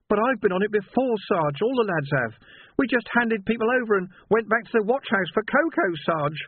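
a lad realising his guilt; distraught young constable. A narrator's reading. Young Sam's guilty confession.

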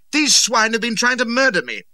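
high, loud cry of protest; outraged outburst. A narrator's reading. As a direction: burst out with it at full pitch. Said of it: "so I burst out".

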